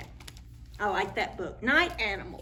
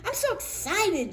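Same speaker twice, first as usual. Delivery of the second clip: excited; 11 semitones higher than usual. Acted. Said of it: "Jim exclaimed".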